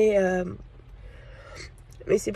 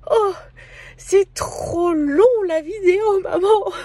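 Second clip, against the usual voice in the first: put-on high, whiny child's voice, drawn out; daughter's complaint; 10 semitones higher, 4 dB louder, slower than usual.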